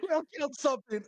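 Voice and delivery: high, excited cheering; overjoyed cheer. Acted. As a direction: crow happily over a first kill. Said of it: "he is so happy".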